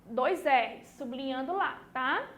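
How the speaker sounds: usual speaking voice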